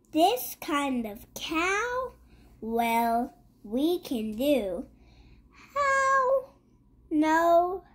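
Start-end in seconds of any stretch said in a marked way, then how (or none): 5.7-6.5 high squeak. Acted. Child reading, sudden high question.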